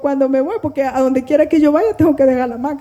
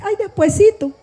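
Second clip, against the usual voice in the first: imitated excuse, pitched up; people putting it off; 7 semitones higher than usual. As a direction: mimic a polite, high-voiced brush-off. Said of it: "Ustedes han oído la gente que dice".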